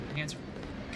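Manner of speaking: a voiceless whisper of the line. Shown as a demonstration. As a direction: whisper it to yourself.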